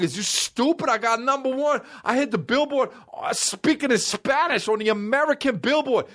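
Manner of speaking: sustained high-pitched rapper rant. Retold or imitated. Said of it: "Why are you such an upset little girl?".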